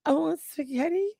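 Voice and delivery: soft, high imitation of tearful pleading; mock crying. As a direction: imitate someone weepily begging for food, slowly. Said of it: "and then she was crying".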